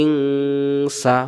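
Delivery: ইংসা with the nasal ng held long and humming before sa; long nasal ikhfa. Shown as a demonstration. Quran teacher showing students the ikhfa nasal hold. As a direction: stretch ইংসা into a slow humming nasal, very drawn out. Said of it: "এই জন্য এখানে হবে".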